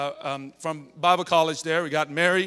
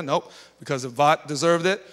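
normal talking voice

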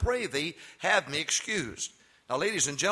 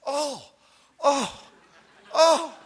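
put-on high, rising gasps of a scandalised old lady, louder each time; mock shocked old lady; 10 semitones higher, 8 dB louder, much slower than usual. Preacher mimicking choir ladies gasping at him, high mock shock. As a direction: imitate a choir lady clutching her pearls, mock horror. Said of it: "don't do this".

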